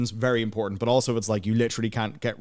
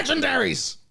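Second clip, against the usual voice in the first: hyped gamer. Pretend. Gamer hype voicing.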